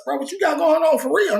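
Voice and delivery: high, pressing question; asking the friend what he's hiding, voice up high; eager curiosity. Retold or imitated.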